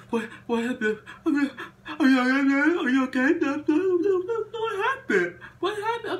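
high, fast fussing doctor voice with laughs; hammed-up worried doctor; panicky doctor. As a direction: play the doctor fussing over the cuts. Doctor voiced in retold story.

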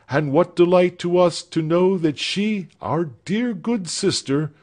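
a hint of a tender young woman speaking to her sister; gently voiced young woman. Character dialogue voiced by reader.

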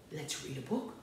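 very soft and low; hushed invitation. Real, not acted. Hushed lead-in to story time.

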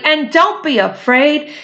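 high, rising encouragement; encouraging. Acted. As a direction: urge them on, high and bright. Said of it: "called Dilly".

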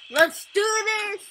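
high, loud, slow hype call; hype shout. Real, not acted. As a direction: pump everyone up. Hyping up a new game.

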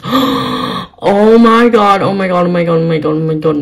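real overexcited outburst; freaking out. Real, not acted. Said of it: "I'm freaking out right now".

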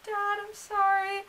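high, slow, tender plea; mock-weepy small voice; imitated crying apology. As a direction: softly act a tearful son apologizing. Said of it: "Levi was crying".

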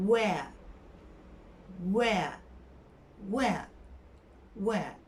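"Where." said softly with a w, no r; repeated correct where. Shown as a demonstration. Teacher drilling "Where." for the class. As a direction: drill "Where." slowly with rounded lips. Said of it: "Şöyle olacak ağzım".

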